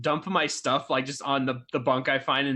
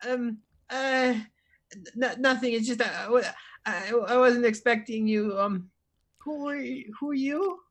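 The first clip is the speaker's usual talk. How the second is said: put-on high, hesitant stammering kid; nervous Timmy; 6 semitones higher than usual. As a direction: stumble over words, startled by a stranger. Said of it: "I look around like".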